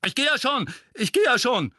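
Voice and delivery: high, loud, hurried giving in; yelping surrender. A narrator's reading. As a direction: cry out to stop the blows. Mundungus yelps while being hit.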